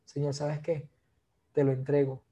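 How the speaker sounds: hushed, sincere surrender; quiet prayer. Acted. Preacher surrendering his phone to God, quiet prayer. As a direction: hand it over to God softly.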